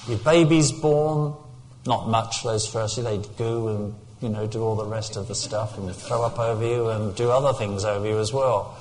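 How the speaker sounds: ordinary talk, nothing marked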